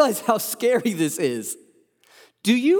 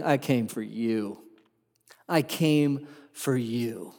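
much softer, lower and slow; tender reassurance; 6 semitones lower, 4 dB quieter, slower than usual. Acted. Jesus reassuring the hopeless sinner, soft and slow. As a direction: say it gently, like a promise to someone despairing.

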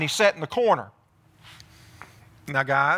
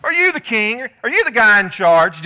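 loud, higher mocking demand; loud scornful Jezebel jeering at her husband; 4 semitones higher, 7 dB louder than usual. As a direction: belittle him, pushing hard. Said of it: "Jezebel demanded".

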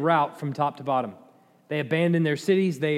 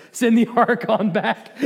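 higher, louder, breaking into a laugh; 5 semitones higher, 3 dB louder than usual. Real, not acted. Preacher amused by the hilarious ark story, laughing. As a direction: let the laugh burst through the words.

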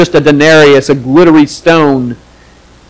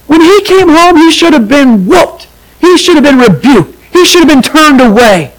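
bitter outburst; 9 semitones higher than usual. Acted. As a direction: spit out the resentment, much higher. Older brother's bitter outburst.

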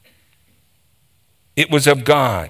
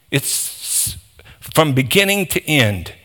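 ordinary talk

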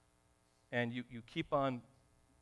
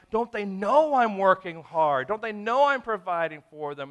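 mild mock anger; 4 semitones higher, 10 dB louder than usual. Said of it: "you start to get angry".

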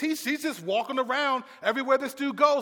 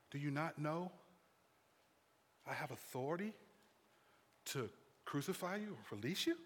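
very low, soft, measured delivery; hushed preaching; 8 semitones lower, 15 dB quieter than usual. Acted. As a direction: drop to a grave near-murmur with long pauses. Preaching, hushed, as Pilate then commentary.

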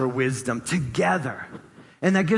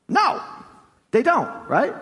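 emphatic no; 8 semitones higher than usual. Acted. Rhetorical answer to congregation.

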